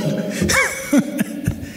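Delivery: high burst of breathless laughter; hysterical laugh. Real, not acted. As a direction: crack up helplessly. Laughs at minister's blunder.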